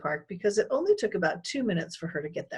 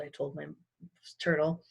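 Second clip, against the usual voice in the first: ordinary delivery, nothing marked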